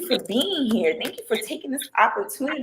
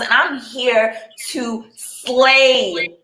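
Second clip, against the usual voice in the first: sassy slow boast; 4 semitones higher, 7 dB louder, much slower than usual. Real, not acted. Host self-introduction hype.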